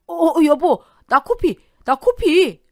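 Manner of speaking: high, loud alarmed cry; panicked husband. Acted. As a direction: call out to your wife in a panic. Beaten husband panics at nosebleed.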